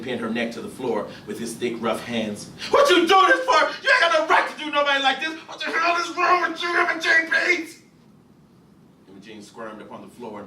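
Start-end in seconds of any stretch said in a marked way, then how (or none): 2.7-7.8 high, loud, furious rebuke; a man shouting at the husband for hitting her; outraged shout. Acted. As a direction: yell it at him. Someone shouts at Gus.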